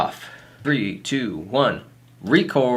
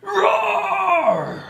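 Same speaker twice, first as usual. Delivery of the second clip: monster roar. Acted. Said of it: "You can't say rar".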